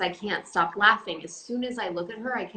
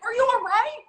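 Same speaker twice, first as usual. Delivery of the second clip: high, loud mock concern, teasing a friend; hammed-up worried "are you all right"; mock concerned coworker; 13 semitones higher, 6 dB louder than usual. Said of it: "you being like".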